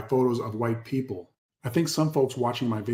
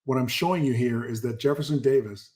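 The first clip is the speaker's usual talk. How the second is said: ordinary delivery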